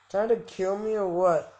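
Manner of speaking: ordinary talk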